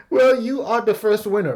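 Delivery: loud, high and bright winner reveal; excited announcement. Real, not acted. Host announcing the first winner to viewers, excited and loud. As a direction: call out the winner with a burst of energy.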